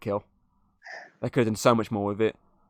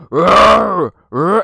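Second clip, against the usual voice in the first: loud, high mock monster roar; hammed-up creature growl; imitated shark roar; 7 semitones higher, 9 dB louder than usual. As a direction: roar like a cheesy dinosaur. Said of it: "a fucking shark roars".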